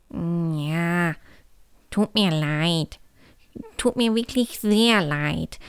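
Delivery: slow, sheepish apology; contrite. A narrator's reading. Hugo apologises to the elf, slightly mumbled remorse. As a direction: own up slowly and sheepishly.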